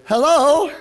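high, loud singsong hello, rising; nice voice. Retold or imitated. Soul winner calling to the lady inside, in a nice voice. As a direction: call out extra nicely. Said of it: "Nice voice".

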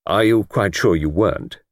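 plain reading voice

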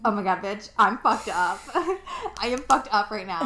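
loud, teary laughter, overwhelmed; real tears breaking into laughs; laughing through tears. Real, not acted. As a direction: laugh it off while actually crying. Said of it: "I have tears rolling down my face".

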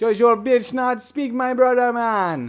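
hammed-up Jamaican accent; put-on Jamaican accent. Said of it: "Jamaican cruise".

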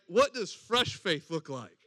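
normal talking voice

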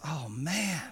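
soft, sinking lament; quietly lamenting to himself; softly voiced dismay; mock dismay. Fired manager laments his lost job.

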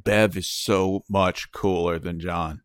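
slow, put-on gushing voice; drawn-out lightly voiced fan; slow, lightly put-on admiration; mock fan voice. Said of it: "they're like".

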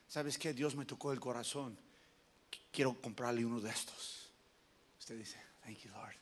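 low, soft acted offer; quiet, low aside. Preacher acts a pastor offering a gift, low and quiet. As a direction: drop right down, humble and hushed.

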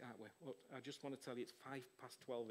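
usual speaking voice

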